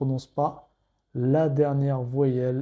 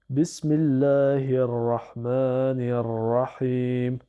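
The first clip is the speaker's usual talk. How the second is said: very slow, every shadda and long vowel marked; slow careful recitation; much slower than usual. Shown as a demonstration. Teacher pronouncing every shadda for learners, very slow. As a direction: lean on each doubled consonant. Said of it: "je prononce bien toutes les shaddas".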